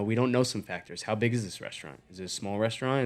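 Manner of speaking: ordinary talk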